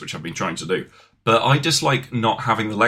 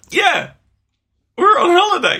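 holiday cheer; 6 dB louder than usual. Pretend.

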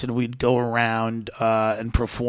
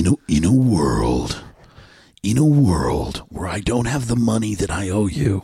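movie trailer voice; 3 semitones lower than usual. Pretend. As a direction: announce it like a blockbuster trailer. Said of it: "the movie trailer voice".

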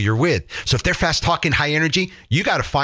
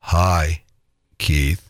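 much lower, slow droning voice; low-energy caller; 7 semitones lower, slower than usual. Shown as a demonstration. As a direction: drag it out like a glum Eeyore. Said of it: "like nails on a chalkboard".

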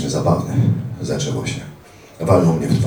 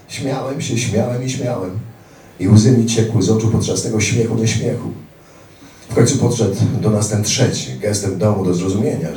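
usual speaking voice

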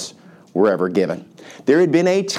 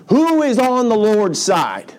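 slow, high-pitched rallying call; yelled challenge; 8 semitones higher, slower than usual. Acted. Preacher repeats Moses' line as a line is drawn, high call. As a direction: throw the challenge out across a camp. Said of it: "So he yells this out".

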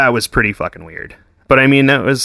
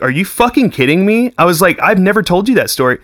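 stunned disbelief; 8 semitones higher than usual. Retold or imitated. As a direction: hit her with total disbelief. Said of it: "I'm like".

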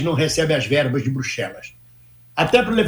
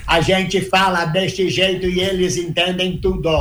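mock Brazilian accent; slower than usual. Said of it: "quando eles imitam".

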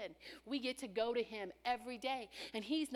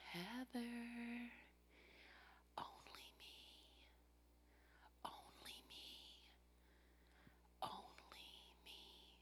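soft unvoiced whisper, slow; whispered still small voice; 16 dB quieter, slower than usual. God answering the worried believer in a soft slow whisper.

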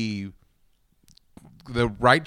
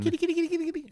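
calling a cat; 6 dB quieter, much faster than usual. Acted.

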